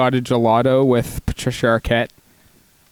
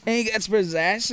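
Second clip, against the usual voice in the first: ordinary delivery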